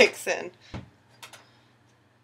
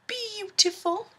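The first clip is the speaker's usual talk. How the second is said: high, slow admiring coo; drawn-out delighted praise of the cupcakes; 11 semitones higher, slower than usual. Real, not acted. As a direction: savour the word with delight.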